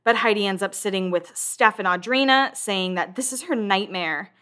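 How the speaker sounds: usual speaking voice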